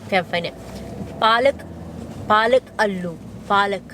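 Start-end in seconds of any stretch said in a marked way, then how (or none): none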